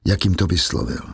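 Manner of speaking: ordinary delivery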